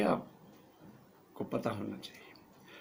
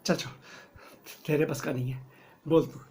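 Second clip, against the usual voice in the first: usual speaking voice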